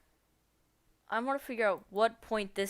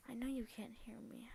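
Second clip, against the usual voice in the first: whispering; 13 dB quieter than usual. Real, not acted. Talking to muted chat.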